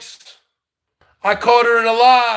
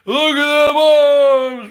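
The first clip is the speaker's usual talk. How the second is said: louder, higher and slowed right down, showing off his arms; slow flexing shout; 4 semitones higher, 3 dB louder, slower than usual. Real, not acted. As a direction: drag it out like a boast. Taunting rival, flexing arms.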